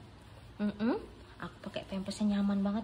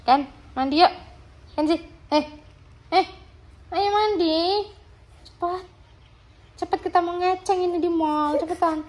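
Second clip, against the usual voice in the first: coaxing call; 10 semitones higher, 7 dB louder than usual. Real, not acted. Parent coaxing baby into bath.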